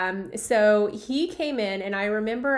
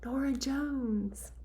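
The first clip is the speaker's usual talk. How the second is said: softer, slow confiding answer; quiet reveal; 4 semitones higher, 6 dB quieter, much slower than usual. Retold or imitated. Proud mom confides her daughter's name, slow and soft. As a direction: let the name drop softly. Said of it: "And she goes".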